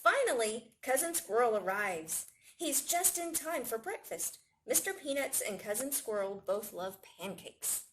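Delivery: ordinary delivery, nothing marked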